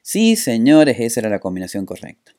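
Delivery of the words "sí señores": booming prize announcer. Real, not acted. Celebrates winning the game.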